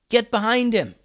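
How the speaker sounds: high-pitched urgent call; yelling. A narrator's reading. Alex orders friends behind the rolling pirate, yelling. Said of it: "yelled Alex".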